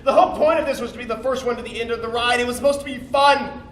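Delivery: high, upset complaining; distressed protest. Acted. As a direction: protest in rising dismay. Protesting as the ride goes wrong.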